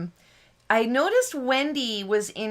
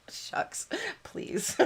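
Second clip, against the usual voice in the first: bashful shrug-off; 10 semitones higher, 10 dB quieter than usual. Retold or imitated. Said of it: "I'm like".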